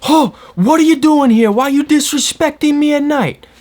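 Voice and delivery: high, outraged mock scream; screaming hillbilly. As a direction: yell like a crazed hillbilly defending his home. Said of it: "screaming at the top of his lungs".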